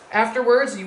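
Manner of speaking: usual speaking voice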